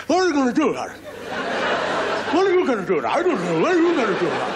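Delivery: high squeaky frog chorus, laughing through it; a crowd of frantic little frogs; panicky frogs. Acted. Frogs fretting to each other, in a high panicky squeak.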